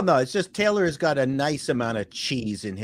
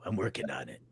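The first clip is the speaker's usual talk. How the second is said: deep, quiet groggy morning voice; low sleepy mumble like a man just woken; Chris Gore impression; 9 semitones lower, 11 dB quieter, faster than usual. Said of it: "That's my Chris Gore now".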